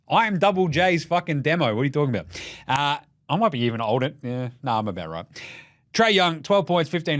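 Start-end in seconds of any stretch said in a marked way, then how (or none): none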